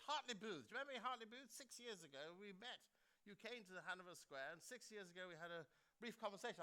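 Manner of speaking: a hint of an upper-class official on the phone; mildly plummy imitation of a caller; posh caller. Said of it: "'cause he's very posh".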